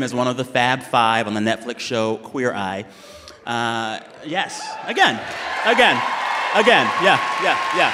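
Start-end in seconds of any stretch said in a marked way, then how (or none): 4.2-7.9 cheering shout. Real, not acted. Host cheering the crowd on.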